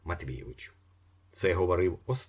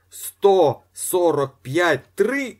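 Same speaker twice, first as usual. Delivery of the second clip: high, loud, slow drawn-out auction call; auctioneer's call; 11 semitones higher, 10 dB louder, much slower than usual. A narrator's reading. Auctioneer calling the last count, drawn out and loud. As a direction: stretch the final count.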